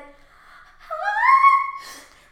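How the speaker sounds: high, loud held shriek; long comic scream of shock; mock scream. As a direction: shriek at full volume in mock terror. Scream retold, "why are you screaming.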